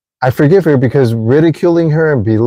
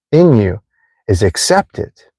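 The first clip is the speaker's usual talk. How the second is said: ordinary talk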